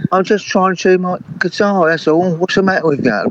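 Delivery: hammed-up huffy retort to the hecklers; tipsy indignant singer. Drunk singer argues with crowd.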